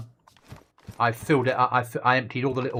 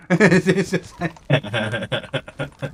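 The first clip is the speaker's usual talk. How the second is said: words breaking into a long laugh; laughing disbelief; 4 semitones higher, 5 dB louder, much slower than usual. Real, not acted. Gamer mocking praise of a buggy game, laughing in disbelief. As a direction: laugh through the repeated question. Laughs repeating his incredulous question.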